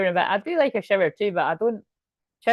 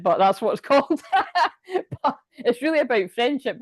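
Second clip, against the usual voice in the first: laughing; 6 semitones higher than usual. Real, not acted. Laughing at film title Bride Wars.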